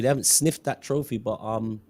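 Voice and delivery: ordinary talk, nothing marked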